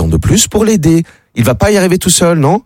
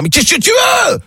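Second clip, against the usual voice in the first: much higher furious yell; furious shout. Acted. Woken homeowner yells through the door at the drunk, furious shout. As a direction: bellow it at the late-night intruder. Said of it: "il demande, furieux".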